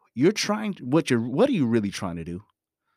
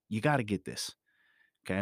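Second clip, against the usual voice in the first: normal talking voice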